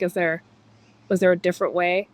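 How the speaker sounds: ordinary delivery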